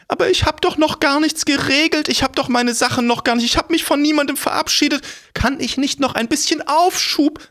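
fast, high, begging crone voice; pleading old woman. Acted. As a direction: rattle off pleas like a panicked old lady.

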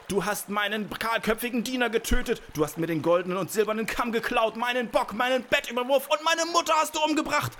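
fast, high tirade building to a shout; furious accusation. A narrator's reading. Giant accuses the girl of everything she did, mounting fury. Giant lists the girl's crimes.

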